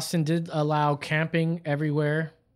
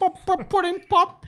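pop, pop, pudding pop squeaked way up high; a goofy falsetto ad jingle; silly high voice; 13 semitones higher, 4 dB louder than usual. Acted. Host riffing on a pudding pop joke, in a goofy high falsetto.

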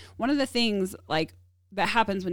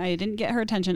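ordinary talk, nothing marked

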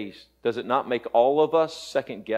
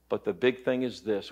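usual speaking voice

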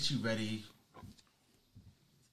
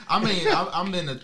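laughing; 7 semitones higher, 11 dB louder than usual. Real, not acted. Still laughing after the burst.

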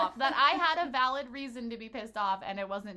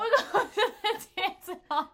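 laughing; 10 semitones higher, 7 dB louder than usual. Pretend. Joking old-timey announcement amid laughter.

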